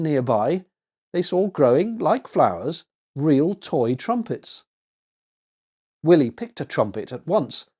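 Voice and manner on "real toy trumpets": ordinary delivery, nothing marked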